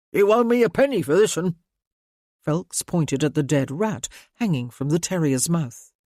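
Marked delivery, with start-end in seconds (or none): 0.1-1.5 cheeky ratcatcher. A narrator's reading. Ratcatcher asks payment.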